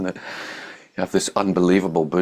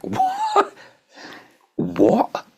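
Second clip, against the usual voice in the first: high, squeaky double what of disbelief; astonished; 4 dB louder than usual. Retold or imitated. Reading Jesus' words for the first time, squeaky astonishment. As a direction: gasp it out like you can't believe the page.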